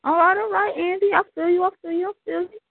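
real high-pitched hype shout; hype host. Real, not acted. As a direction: pump up the crowd. Host hyping the crowd.

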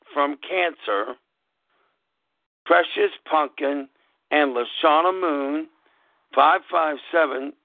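slow, spelling out a web address letter by letter; slow dictation. Shown as a demonstration. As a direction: read the address out slowly and clearly. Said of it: "Now, how does it sound?".

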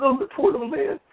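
high-pitched sarcastic sympathy; mock pity. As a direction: coo over him in exaggerated pity.

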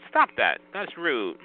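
ordinary talk

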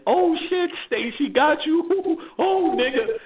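high, slow laughing tease; laughing taunt. Retold or imitated. Teasing friend who got a ticket, high laughing taunt. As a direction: crow at your friend through laughter. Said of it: "So now I'm laughing".

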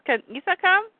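put-on high, eager kid question; child voice. Rafi asking about his dog, high child voice. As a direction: ask it up high like a small child. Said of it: "He says".

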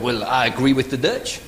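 low, slow mock French accent; disdainful Frenchman with French accent. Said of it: "The French looked around and went".

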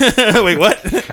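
high, laughing "Wait, what?"; laughing disbelief. Real, not acted. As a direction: laugh out the question in real disbelief. Stunned by stranger knowing him.

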